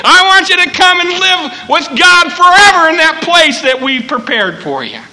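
high, shouted full-force preaching plea; Jesus calling loudly. Acted. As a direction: call sinners home at top voice, easing at the end. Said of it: "he calls to us with a loud voice".

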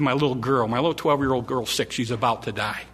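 soft, low, desperate plea; a father's soft desperate plea; hushed pleading father. Acted. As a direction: beg quietly for his dying girl.

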